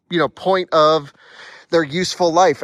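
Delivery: ordinary talk, nothing marked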